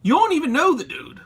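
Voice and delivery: high-pitched disbelief at the trolls; sarcastic high voice calling out online haters; incredulous retort. Retold or imitated. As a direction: scoff at them, pitch way up. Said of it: "I'm like".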